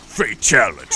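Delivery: hammed-up arcade announcer declaring Fatality; mock fatality announcer. Shown as a demonstration. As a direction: boom out Fatality. like a fighting-game announcer. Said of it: "That's what the fatal".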